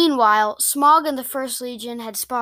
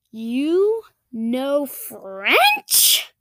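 very slow, drawn-out disbelief, rising at the end; incredulous question; much slower than usual. Acted. Girl amazed at her friend's French, incredulous drawl. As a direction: stretch each word in amazement.

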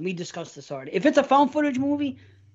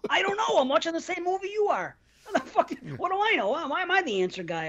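exasperated retort; 6 semitones higher, 4 dB quieter than usual. Retold or imitated. Retold exasperated reply to friend.

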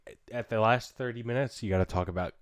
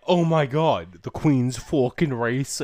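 mock shocked quote; 3 semitones higher, 7 dB louder, slower than usual. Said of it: "she was like".

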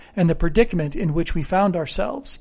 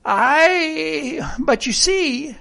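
high, drawn-out protesting "Aye"; pleading rustic sailor, pitched up; castaway character; 10 semitones higher, much slower than usual. Acted. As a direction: object eagerly, voice swooping up.

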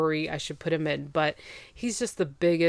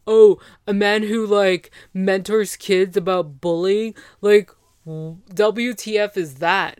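louder, drawn-out mocking quote; mock scoffing; 6 dB louder than usual. Ex belittles the date to friends, mock scoffing. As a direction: scoff loudly as the sneering ex.

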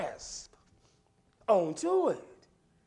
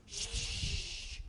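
hush; 9 dB quieter than usual. Retold or imitated. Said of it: "And they said".

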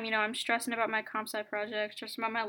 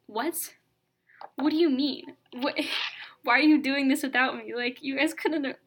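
louder, incredulous whine at the family; mock outrage; 3 semitones higher, 6 dB louder than usual. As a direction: protest like you've been left out, half laughing. Acted outraged reaction to family.

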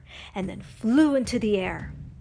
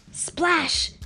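ordinary delivery, nothing marked

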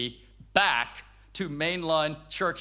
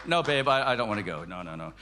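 dejected refusal; 10 semitones lower, faster than usual. Retold or imitated. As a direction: imitate a depressed husband begging off. Replays his depressed refusals to wife.

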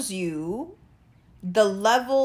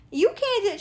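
high disbelief; 10 semitones higher than usual. Retold or imitated. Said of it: "I was like".

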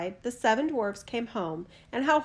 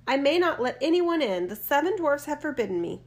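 put-on young girl refusing; 6 semitones higher, 4 dB louder than usual. A narrator's reading.

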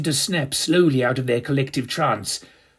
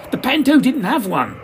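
much higher called-out answer; yelled reply; 9 semitones higher than usual. Acted. Stage manager yelling back at the narrator, high. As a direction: shout back over the racket. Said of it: "he yelled at me".

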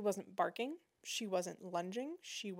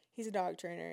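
normal talking voice

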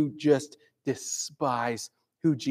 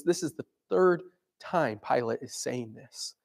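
ordinary delivery

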